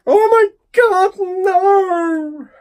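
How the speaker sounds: very high, loud howl of dismay; despairing wail. Real, not acted. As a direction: wail it out, dragging the last word. Despair at the film he drew.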